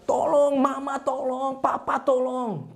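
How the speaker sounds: high, drawn-out wail for help; cry for help. Acted. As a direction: play a falling chick calling its parents. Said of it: "anak itu teriak". (teriakan minta tolong)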